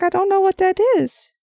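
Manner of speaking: playing her younger self, baffled; confused kid self. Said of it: "And I'd be like".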